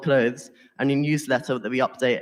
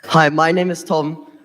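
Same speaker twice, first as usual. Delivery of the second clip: louder and slower, with a laugh; louder restart; 3 semitones higher, 7 dB louder, slower than usual. Real, not acted. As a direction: start over louder and slower over the echo. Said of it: "hard to talk with the echo".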